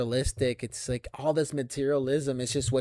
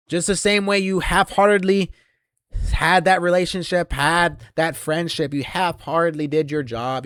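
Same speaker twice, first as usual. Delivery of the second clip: higher, louder rant; louder, higher mocking rant; ranting shout; 5 semitones higher, 8 dB louder than usual. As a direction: raise the voice in scorn.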